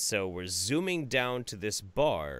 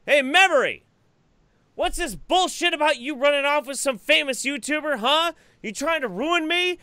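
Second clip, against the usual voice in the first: much higher and louder, a hammed-up furious yell; jealous mock rant; 9 dB louder than usual. Mock-jealous husband yelling at his wife over the gate. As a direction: shout a fake jealous tirade across the gate. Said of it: "I'm like".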